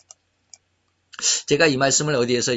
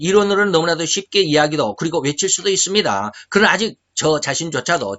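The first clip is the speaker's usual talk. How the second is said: loud, ringing preacher's appeal; full-force preaching; 4 semitones higher, 5 dB louder than usual. Acted. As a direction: proclaim it to the whole congregation. (힘차게 외치는 설교)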